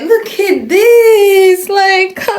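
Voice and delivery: loud, high, slow excited cry; thrilled shout. Real, not acted. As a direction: shout with joy at the gift. Thrilled by new heels.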